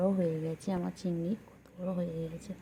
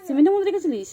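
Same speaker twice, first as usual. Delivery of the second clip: sharp raised voice, high and loud; loud scolding; 12 semitones higher, 13 dB louder, faster than usual. Real, not acted. Mother shouting across the room, raised and sharp. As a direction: call out the question hard.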